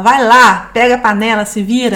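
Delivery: loud dismissive snap; rude wife retold. Wife retold brushing off her husband, loud. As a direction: toss the words at him scornfully. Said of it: "E ela fala".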